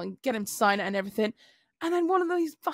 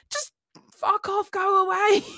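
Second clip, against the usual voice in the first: high, whiny mock yell; retold exasperated plea; 9 semitones higher, 3 dB louder, slower than usual. Driver to colleague who starts talking, exasperated. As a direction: wail it, high and fed up. Stressed driver begging to be left alone.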